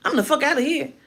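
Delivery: ordinary talk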